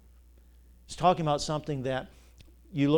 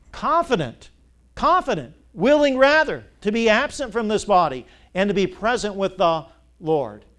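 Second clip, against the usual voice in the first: higher and louder, hammering the words home; preaching at full force; 5 semitones higher, 8 dB louder than usual. Acted. Preacher shouting the promise to his church, ringing out loud. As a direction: rise up and proclaim it to the congregation.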